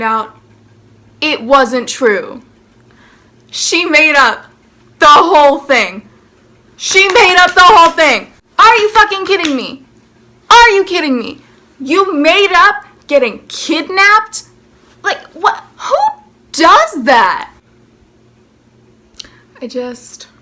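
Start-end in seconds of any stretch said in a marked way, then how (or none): 3.5-8.3 loud, high outraged disbelief. Real, not acted. Telling viewers the girl lied, loud outrage. 8.6-17.5 loud, high furious disbelief; incredulous rant. Real, not acted. Calling out the girl who faked a kidnapping, loud rant. Ranting at the liar.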